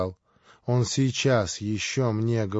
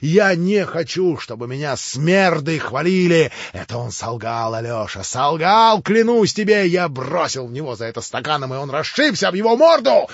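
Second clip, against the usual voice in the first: louder, much higher, near shouting; raving fury; 5 semitones higher, 7 dB louder than usual. Acted. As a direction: rant wildly, out of control. Ivan raving, swearing to brother. (в бреду, яростно)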